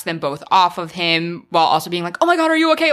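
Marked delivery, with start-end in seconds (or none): 2.2-2.9 higher and louder, genuinely concerned; mock alarmed concern; worried. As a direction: check on someone you just knocked down. Voicing a worried fighter.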